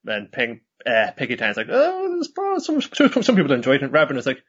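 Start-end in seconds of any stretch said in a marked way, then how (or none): 1.7-3.8 mascot voice. Retold or imitated. Voicing the mascot Peggy Tan.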